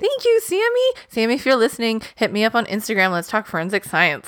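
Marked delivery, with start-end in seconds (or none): 0.0-0.9 gushing. Real, not acted. Gushing thanks.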